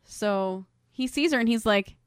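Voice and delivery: normal talking voice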